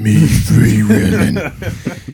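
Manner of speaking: slow demon growl, half laughing. Retold or imitated. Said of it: "that same demonic voice".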